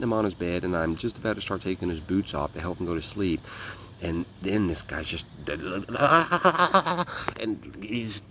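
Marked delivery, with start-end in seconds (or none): 5.3-7.4 stuttering, high-pitched mimicked seizure noise; mock convulsing stammer; seizure noise imitation. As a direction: rattle out a juddering seizure sound. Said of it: "he starts having a seizure".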